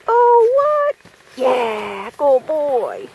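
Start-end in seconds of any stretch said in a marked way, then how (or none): 0.1-0.9 loud, high surprised cry; sudden loud surprise. Real, not acted. Dog surprises owner.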